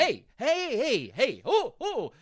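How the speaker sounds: high, loud, repeated yelps; startled protest. Acted. Host acting out being touched from behind, startled protest. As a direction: yelp as if someone touched your thigh. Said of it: "touch your inner thigh".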